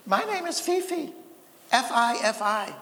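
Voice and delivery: high-pitched voice for a small dog; little dog Fifi. Acted. First dog greets the other two in a joke, high dog voice. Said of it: "the first dog says to the other two".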